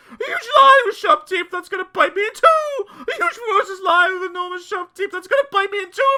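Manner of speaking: hysterical, loud high-pitched wail; panicked shrieking. A narrator's reading. Mister Jelly sure a lion will bite him, shrieking hysterically. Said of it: "shrieked Mister Jelly".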